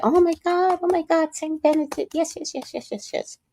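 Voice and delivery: high, gushing mock delight; excited high chorus that fades at the end; gushing approval. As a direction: imitate a thrilled crowd, very high. Crowd thrilled by Saint Benedict.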